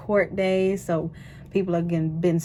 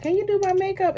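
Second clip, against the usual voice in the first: much higher, whiny mock request; mocking a pushy asker; 14 semitones higher, faster than usual. Mimicking people who beg her to do their makeup, high and whiny. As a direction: imitate the people who keep asking. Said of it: "But people like".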